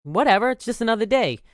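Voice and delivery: a high, airy "eh" that brushes it off; dismissive shrug. Retold or imitated. Telling the client the election didn't matter, breezily dismissive. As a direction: wave the worry away as no big deal. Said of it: "And I'm like".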